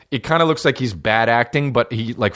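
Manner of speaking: ordinary talk, nothing marked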